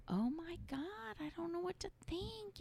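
soft, high, lost inner voice; hushed bewilderment. Retold or imitated. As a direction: murmur it to yourself, helpless. Confused by the diagnosis.

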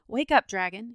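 loud, sharp command. A narrator's reading. Saint George waking the sleeping dragon, sharply. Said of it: "he said sharply".

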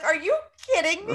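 high, loud retold incredulity; indignant disbelief. Daughter to mom after her reaction, indignant disbelief. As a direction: throw it back in high-pitched disbelief.

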